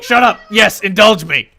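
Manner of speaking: excited mock yell; gleeful shout. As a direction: shout it with delight, begging to show off. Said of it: "And he's like".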